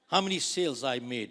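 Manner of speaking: usual speaking voice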